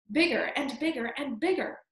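ordinary delivery, nothing marked

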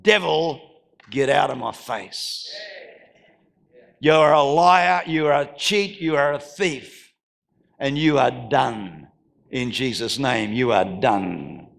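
just say it, low and certain; calm firm rebuke. Shown as a demonstration. Preacher to congregation, rebuking the devil in a low calm voice. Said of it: "you just have to say it".

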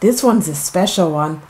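ordinary delivery, nothing marked

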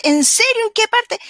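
high, loud disbelief; amazed students. Retold or imitated. Students asking the teacher where to find films, high and amazed. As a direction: kids thrilled by the news.

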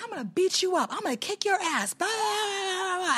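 very high mock threats; mocking the attacker. Imitating the woman's mock charge, high ranting threats. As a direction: mimic empty threats way up high, trailing into babble.

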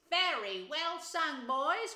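loud, high, slow hearty praise; big welcoming rat voice; hearty cry. A narrator's reading. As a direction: cheer them heartily, drawing the words out. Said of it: "cried the rat heartily".

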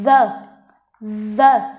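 "The" with a hard, buzzing voiced TH, loud; tongue out, throat vibrating on the start of "The". Shown as a demonstration. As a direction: press the voice into the TH of "The". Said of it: "mình sẽ gằn giọng hơn".

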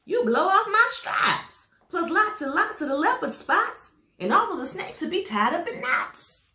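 high, loud panicked yell; zebra yelling. Acted. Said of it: "The zebra yelled".

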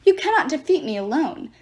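lightly voiced wounded giant; groaning giant. A narrator's reading. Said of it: "the giant groaned".

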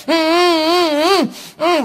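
high muffled hums through taped mouth; mock muffled humming; muffled moans.

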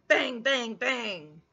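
loud bang, bang, then fading; banging sounds. Acted. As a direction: hit the first bang hard. Banging on the door.

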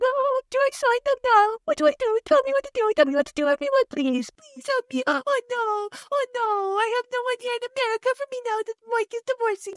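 very high, whimpering fake cry; mock wailing. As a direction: wail helplessly in a hammed-up squeaky falsetto. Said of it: "wailing and crying".

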